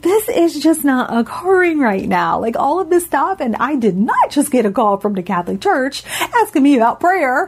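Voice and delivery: can't believe this is happening; retelling her own shocked disbelief. Retold or imitated. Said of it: "I'm just like".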